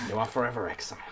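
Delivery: low, soft verdict from a solemn father; regretful kobold father. Acted. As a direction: pass sentence on your son quietly, with regret. Kobold father banishes his son.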